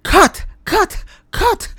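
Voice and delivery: high, loud repeated yells; director shouting. A narrator's reading. Film director yelling at the actors to stop. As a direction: bellow it like a furious director. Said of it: "Mister Rene shouted".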